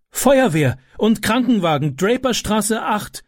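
high urgent call into the phone; mother on the phone, urgent and high. A narrator's reading. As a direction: give the address clearly and urgently. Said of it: "rief sie".

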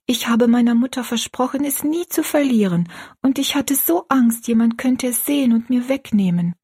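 put-on high, girlish character voice, anxious; earnest young Rosalie. Rosalie explains her hidden keepsake to her friend, high and earnest. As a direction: confide it earnestly, pitched well up.

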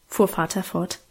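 plain reading voice

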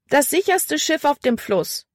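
high-pitched call to a crowd. A narrator's reading. Libby drums up passengers for the Christina, high calling voice. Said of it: "rief sie".

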